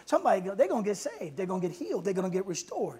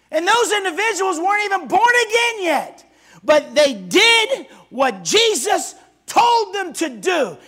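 shouted preaching; 6 semitones higher, 10 dB louder than usual. Acted. Preacher proclaiming the power of the name, shouted. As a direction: stretch the words and thunder them out. Preacher keeps full force.

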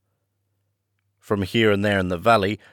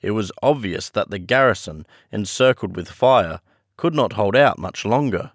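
ordinary delivery, nothing marked

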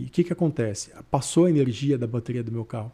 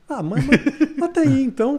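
laughing; 11 semitones higher, 8 dB louder than usual. Real, not acted. Recalling a scam call to a podcast cohost, laughing. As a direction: laugh through it, pitch jumping higher and louder.

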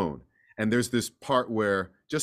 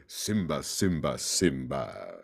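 deep, fading Simba, each lower and softer; Jeremy Irons Scar voice; 10 semitones lower, 6 dB quieter, slower than usual. Retold or imitated. Actor recalls playing Scar, slinking menacing villain voice. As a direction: drawl the name like a sly villain. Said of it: "all that fun Jeremy Irons stuff".

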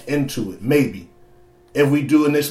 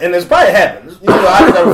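laughing; 3 semitones higher, 6 dB louder than usual. Real, not acted. Laughing while telling a story.

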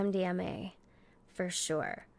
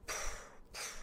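breathy puffs; 13 dB quieter than usual. Acted. Mimicking thrust sounds.

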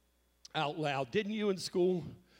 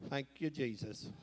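mock limp thanks; 2 semitones lower, 6 dB quieter than usual. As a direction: toss off a half-hearted thank you. Mocking weary church ending.

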